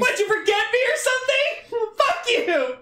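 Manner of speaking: put-on very high, laughing angry girlfriend. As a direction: spit it high through laughter. Yuka voicemail, laughing.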